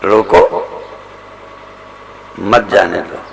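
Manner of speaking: low रोको alone, very long pause, then मत जाने दो; isolated "रोको", then low "मत जाने दो" in one run; pause after रोको. Shown as a demonstration. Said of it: "दोनों साधनाएं हैं".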